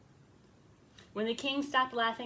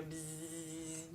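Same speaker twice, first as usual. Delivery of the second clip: low, soft drawn-out bzzz; bee buzz; 6 semitones lower, 11 dB quieter than usual. Acted. Storyteller voices the bee drinking nectar, soft buzzing.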